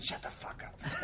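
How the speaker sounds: hushed, slow whispered retort. Retold or imitated. Wife crying at the play, hushed slow whisper to husband. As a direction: hiss it under your breath, slowly. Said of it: "she said".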